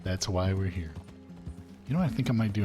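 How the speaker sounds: ordinary talk, nothing marked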